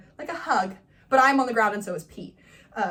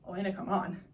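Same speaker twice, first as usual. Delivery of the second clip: muttered repeat; 4 semitones lower, 7 dB quieter, faster than usual. Retold or imitated. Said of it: "Bree begrudgingly says".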